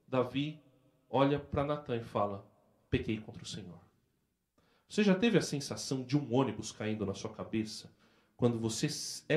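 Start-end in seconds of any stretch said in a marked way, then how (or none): 2.9-3.8 low, very soft admission; subdued, humbled king's confession; hushed confession. Acted. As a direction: confess it under your voice. Confronted king confesses his sin.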